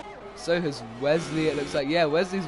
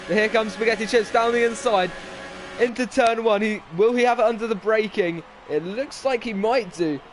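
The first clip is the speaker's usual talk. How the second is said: raised, louder race call; excited commentary; 6 semitones higher, 5 dB louder than usual. Real, not acted. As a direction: call the overtake as it happens. Live overtake into turn one.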